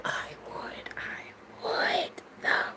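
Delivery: breathy hushed confession; whisper. Real, not acted. Confessing her love of English accents, whispered. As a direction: whisper it like a secret crush.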